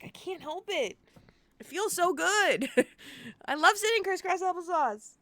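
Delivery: high, hammed-up pleading; mock whining. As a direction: plead your case like a sulky kid. Acted whiny excuse to Sam.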